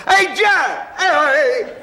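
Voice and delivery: high, loud New York lady greeting. Retold or imitated.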